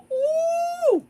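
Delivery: high, loud squeal of delight. Real, not acted. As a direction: squeal over a favourite treat. Delight at McFlurry treat.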